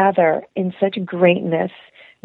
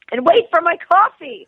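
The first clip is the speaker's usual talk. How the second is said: exasperated dismay; 10 semitones higher, 4 dB louder than usual. Retold or imitated. Embarrassed at waiting behind her.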